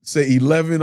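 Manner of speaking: ordinary talk, nothing marked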